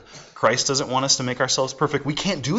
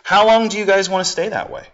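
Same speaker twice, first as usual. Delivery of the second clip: higher, louder pleading call; a raised plea from Lady Wisdom; 6 semitones higher, 5 dB louder than usual. Retold or imitated. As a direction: call out to the foolish ones. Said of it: "And so she says".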